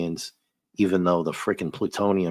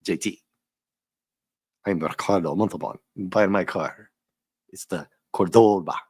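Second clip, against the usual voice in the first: put-on Ricardo Montalban accent. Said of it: "Can you imagine with that accent?".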